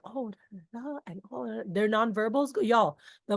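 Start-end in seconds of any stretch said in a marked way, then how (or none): 0.0-1.6 soft, timid filler talk; mock shy murmur; quiet shy mumble. Shown as a demonstration. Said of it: "If they're more quiet or shy".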